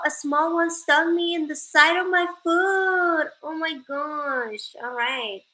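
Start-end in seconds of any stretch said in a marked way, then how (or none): none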